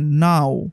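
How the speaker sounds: slow down and model নাও for the learner; say now clearly. Shown as a demonstration. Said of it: "এখানটাতে বলবেন".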